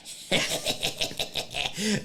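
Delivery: breathy, stuttering mock snicker; imitated giggle. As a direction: mimic a caught kid's long snicker. Imitating child's look and giggle.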